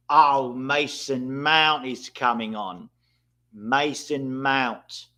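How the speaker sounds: slow, stretched name call; drawn out. Real, not acted. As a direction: draw the name out slowly.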